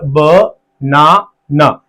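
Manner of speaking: ब, ना, ना as three separate beats; syllable by syllable. Shown as a demonstration. Teacher showing a three-syllable word, syllable by syllable. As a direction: split banana into ब, ना, ना. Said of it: "triple syllable हो गया".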